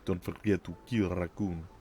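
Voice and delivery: put-on lightly done Russian accent, dropped articles; a hint of a Russian announcer. Quoting the game's Russian narrator, light Russian accent. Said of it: "that Russian accent".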